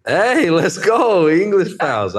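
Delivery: high, loud, drawn-out cheer of delight; excited cheer. Real, not acted. Greeting a fellow England missionary, excited cheer. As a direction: whoop it out, thrilled to find a fellow England missionary.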